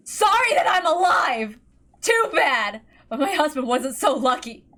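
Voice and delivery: angry, tearful. Acted. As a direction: lash out through tears. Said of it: "obscured by anger and tears".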